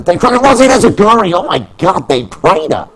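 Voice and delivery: big high shout as the move lands; excited yell. Acted. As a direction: scream the move like a hyped commentator.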